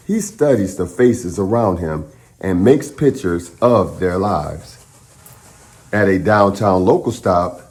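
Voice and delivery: put-on steady, clear read-aloud voice for children; story narration. Host reading a picture book to the class, in a clear storytelling voice.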